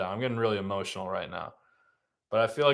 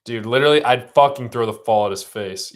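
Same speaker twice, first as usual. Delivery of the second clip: loud, heated venting; real angry outburst; 8 dB louder than usual. Real, not acted. Fan fuming over the ball play, angry outburst. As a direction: vent it loudly, fed up with the play.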